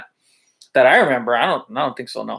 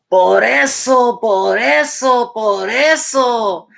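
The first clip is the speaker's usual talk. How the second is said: slow, loud, higher mock yelling; imitating a yell; 7 semitones higher, 5 dB louder, much slower than usual. Teacher imitating the older lady yelling at the cyclist, mock angry. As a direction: drag out each por eso like an angry scolding. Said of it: "she's yelling at him".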